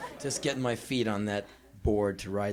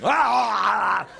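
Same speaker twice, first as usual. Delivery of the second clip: high, loud open-mouthed yowl; exaggerated slobbering-kiss noise; loud kissing wail; 8 semitones higher, 7 dB louder than usual. Self-styled kissing expert at his first kiss, loud yowl.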